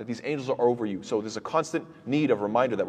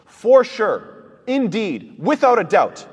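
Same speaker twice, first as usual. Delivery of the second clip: each phrase higher and louder, hammered home; emphatic; 8 semitones higher, 7 dB louder, much slower than usual. Shown as a demonstration. Teacher stressing the triple emphasis to the class, emphatic and loud. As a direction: drive in all three layers of emphasis. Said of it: "So three layers of emphasis".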